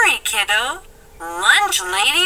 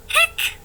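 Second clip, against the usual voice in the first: hiccup; 13 semitones higher than usual. Acted. Jamie hiccuping.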